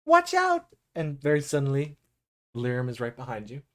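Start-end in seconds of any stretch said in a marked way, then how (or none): 0.0-0.7 high, loud little creature's cry; child shouting a warning. Acted. Child of the forest warns Simon, high shout.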